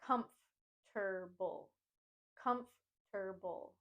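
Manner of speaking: comfortable slowly broken into COMF, ter-bull; "comfortable" slow, with a gap after COMF; syllable by syllable. Shown as a demonstration. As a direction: slowly split comfortable for a learner. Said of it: "Think of it as a three-syllable word".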